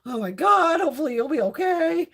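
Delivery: worried quote. Retold or imitated. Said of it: "I'm like".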